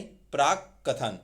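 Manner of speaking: प्राकथन drawn out slowly and clearly; slow correct form. Shown as a demonstration. Teacher repeats the correct word slowly for students. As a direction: stretch out प्राकथन. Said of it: "प्राकथन नहीं".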